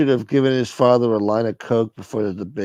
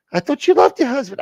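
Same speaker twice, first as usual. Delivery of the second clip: high caller voice; 4 dB louder than usual. Acted.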